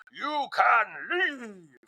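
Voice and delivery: high, drawn-out catchphrase imitation; Meredith Marks. Housewife arriving by golf cart, exaggerated high drawl. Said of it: "Meredith is in the golf cart".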